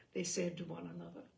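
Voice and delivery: ordinary delivery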